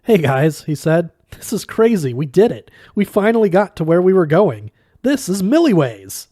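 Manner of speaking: loud, high, thrilled; excited realization. Acted. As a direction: burst out with discovery.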